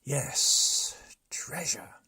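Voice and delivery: breathy gloating whisper; greedy whisper. Acted. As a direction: savour the word greedily. Chief savours word.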